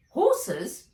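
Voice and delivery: startled question; alarmed. A narrator's reading. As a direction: blurt it out in sudden alarm. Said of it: "said Nat in alarm".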